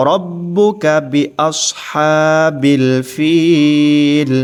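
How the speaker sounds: very slow, drawn-out melodic tajweed; Quranic recitation. Shown as a demonstration. Teacher chanting the verse for students to repeat.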